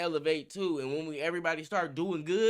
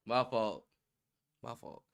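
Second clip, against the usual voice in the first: sheepish apology; 4 semitones lower, 6 dB quieter than usual. Real, not acted. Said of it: "A little bit too much".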